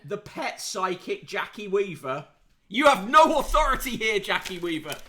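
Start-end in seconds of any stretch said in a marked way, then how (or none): 2.7-5.0 quoting a famous shout. Retold or imitated. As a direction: belt it out like a chair laying down the law at a meeting. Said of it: "It's not that Jackie Weaver".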